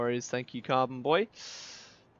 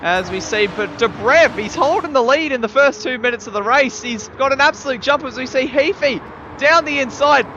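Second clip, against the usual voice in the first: excited, higher-pitched race commentary; live play-by-play; 9 semitones higher, 10 dB louder than usual. Real, not acted. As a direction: call the race live, surging at each incident.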